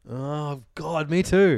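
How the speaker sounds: excited. Real, not acted. Excited agreement on favourite film.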